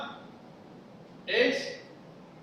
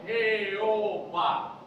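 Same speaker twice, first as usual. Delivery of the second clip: normal talking voice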